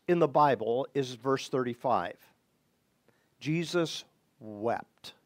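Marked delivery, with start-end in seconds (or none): 3.4-5.1 slow and much softer, lower; hushed reading. Shown as a demonstration. Preacher reading the shortest verse to his congregation, hushed and slow. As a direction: let the verse drop to a hush.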